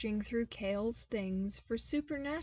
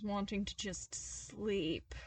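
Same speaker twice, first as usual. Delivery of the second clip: ordinary delivery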